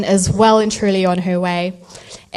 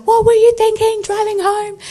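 high, loud mock disbelief at her choice; incredulous friends; 13 semitones higher, 6 dB louder than usual. Friends scolding her for driving home in labour, incredulous. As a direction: squeal it like an outraged friend.

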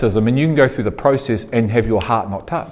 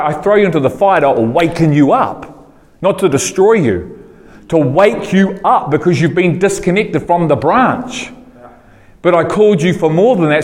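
fiery preaching; 6 semitones higher than usual. Acted. Preacher voicing God to the congregation, fiery and raised. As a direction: drive it home like a preacher on fire.

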